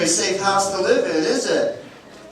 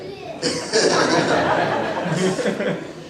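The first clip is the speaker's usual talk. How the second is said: hearty laugh; 2 semitones higher than usual. Real, not acted. Laughs after sandcastle joke.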